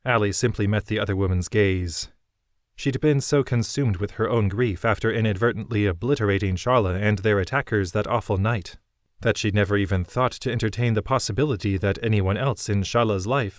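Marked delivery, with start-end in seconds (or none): none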